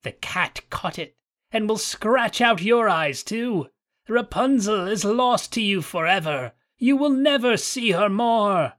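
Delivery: put-on high, cackling taunt of an old witch; mocking witch. A narrator's reading. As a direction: gloat over the prince's loss. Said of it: "cried mockingly".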